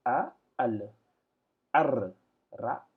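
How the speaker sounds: Arabic syllables read out one by one, detached; slow detached Arabic syllables. Shown as a demonstration. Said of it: "pour la lecture, ça se dit".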